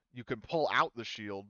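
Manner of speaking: ordinary talk, nothing marked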